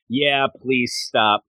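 slowed-down, awkward please stop; slow weary Tony. Retold or imitated. Tony pushing off the hugging Deadpool, slowly and wearily. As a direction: drag it out, uncomfortable. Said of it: "Tony's like".

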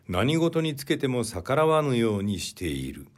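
ordinary delivery

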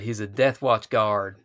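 "Death Watch guard" slow with Texan vowels; Texan drawl. Shown as a demonstration. Co-host renames the Seanchan guard, slow Texan drawl. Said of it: "the Seanchan are Texas".

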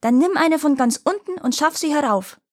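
high-pitched royal bellow; roaring tyrant king. Acted. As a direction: bellow the order back down. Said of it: "Da brüllte der König zurück".

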